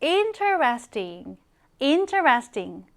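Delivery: slow "interesting" with IN stressed, said twice; stress on the first syllable. Shown as a demonstration. Teacher modelling "interesting" for learners, slowly, stress on the first syllable. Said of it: "강세는 앞에 들어갑니다".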